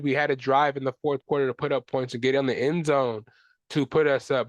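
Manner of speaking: ordinary talk, nothing marked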